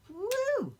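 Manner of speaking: a sudden high whoop; excited whoop. Real, not acted. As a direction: let out a joyful, carefree woo. Shows how she loosens up.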